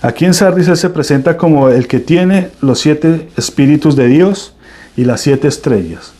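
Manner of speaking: normal talking voice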